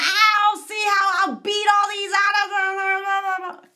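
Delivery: high screamed challenge; screaming challenger impression; mock scream. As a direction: yell a hammed-up threat from outside. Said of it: "someone screaming".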